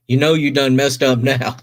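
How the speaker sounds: usual speaking voice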